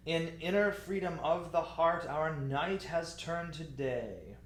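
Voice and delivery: broad recitation that broadens to a long "day"; expressive phrasing. Shown as a demonstration. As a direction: recite in one breath, stretching "night" and "day". Teacher demonstrates phrase between breath marks.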